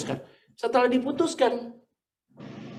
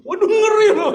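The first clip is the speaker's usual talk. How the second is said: loud, high-pitched comic shock; mock alarm; 13 semitones higher, 9 dB louder than usual. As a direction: exclaim in exaggerated dread, playing for laughs. Mock alarm at child's retort.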